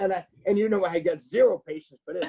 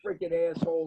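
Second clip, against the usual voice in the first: usual speaking voice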